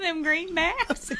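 slow, high, laughing shout. Real, not acted. Host trying to quote the biker, breaking up in laughter. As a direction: laugh through every word. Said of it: "Finish it".

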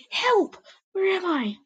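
high, loud cry for help; frightened yelp. A narrator's reading. Apprentice waking in a strange place, yelping high. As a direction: call out lost and scared. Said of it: "I yelped".

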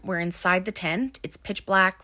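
ordinary talk

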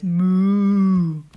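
slow loud moo; mooing cow. Acted. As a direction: moo it out slowly. Cow sound.

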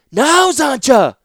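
high, loud, drawn-out slow call; urgent shout. Acted. Ratapi shouting to Zancha to act, slow, high and loud. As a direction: stretch out the command.

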